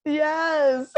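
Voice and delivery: high-pitched laughing yes; laughing agreement. Real, not acted. Podcaster agreeing that points are made, laughing agreement. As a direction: agree while cracking right up.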